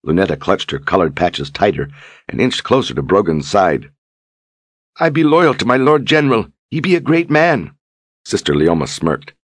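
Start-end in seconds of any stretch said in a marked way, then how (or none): 5.0-7.8 cling to him and declare loyalty; loyal simple woman. Acted. Lunetta refusing Leoma's offer, high and devoted.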